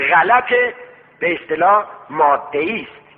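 normal talking voice

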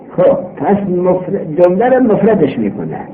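ordinary delivery

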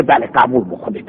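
low, soft "بله، قبول بکنید" said small and pleading; meek plea. Acted. Captive begs the judges to accept, low and soft. Teacher voicing captive's answer.

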